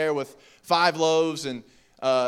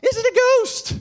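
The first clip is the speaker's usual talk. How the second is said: much higher, louder frightened cry; terrified cry; 6 dB louder than usual. Acted. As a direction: cry out in sudden terror at a ghost. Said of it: "they cried out".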